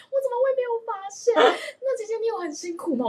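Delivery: high, half-sobbing imitation of a weepy relative; mock tearful sister-in-law. Sister-in-law tearfully asks her older sister, mock sobbing high. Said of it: "他就说".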